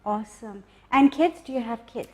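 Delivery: usual speaking voice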